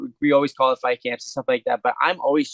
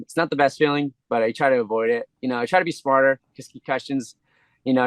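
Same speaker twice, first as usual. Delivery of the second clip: ordinary talk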